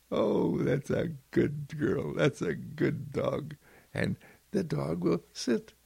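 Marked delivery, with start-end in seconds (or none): 0.1-3.5 slow, tender praise for a good dog; fond slow praise in a changed voice; praising the dog. Pretend. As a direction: coo it to the dog as a reward. Praising dog after it sits.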